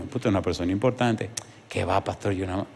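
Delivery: low, soft dismissive answer; soft, self-effacing answer; quiet humble reply. Retold or imitated. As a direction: play it down, low and under your voice. Acted reply of humble deacon.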